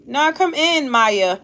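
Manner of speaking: loud high call to someone outside the room. Real, not acted. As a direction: call her in from afar. Calling to Maya at the door.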